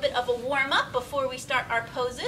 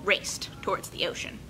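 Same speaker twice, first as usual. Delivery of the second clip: put-on bright, paced read-aloud for kids; story narration. A narrator's reading. Teacher reading a picture book to her class, lively and clear. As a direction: tell the story to a room of children.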